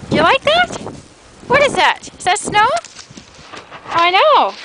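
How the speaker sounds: high, sing-song pet talk. Real, not acted. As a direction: coo at the dog in a high voice. Baby talk to the dog.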